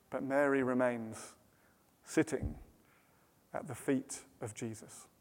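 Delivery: very soft, low, with long pauses; serene hush. A narrator's reading. Serene refrain against Martha's frantic rush.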